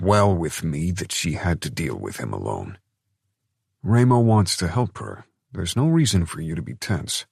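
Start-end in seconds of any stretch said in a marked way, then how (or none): 3.8-7.2 drawling. A narrator's reading. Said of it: "Nino drawled".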